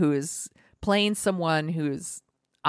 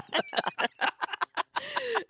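high giggle; 6 dB quieter than usual. Real, not acted. Laughing after cat voice.

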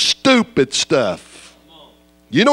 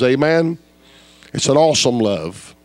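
usual speaking voice

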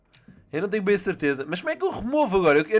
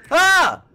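sudden high yelp of fright; startled scream; 9 semitones higher, 8 dB louder than usual. Real, not acted. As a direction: cry out in surprise. Startled by a shark.

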